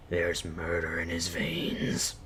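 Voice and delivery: slow, menacing whisper. Acted. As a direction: hush it out like a threat. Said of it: "The larger one then responds".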